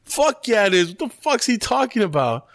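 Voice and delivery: high-pitched outraged retort; indignant. Retold or imitated. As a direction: snap back incredulous, pitched high. Acted outraged retort in retelling.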